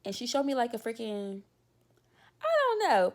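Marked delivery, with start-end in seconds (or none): 2.4-3.1 exasperated outburst. Real, not acted.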